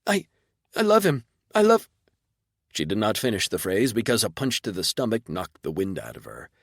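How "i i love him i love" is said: choked, high and loud; gasping out her love; desperate high plea. Acted. Pinned, choking, pleading.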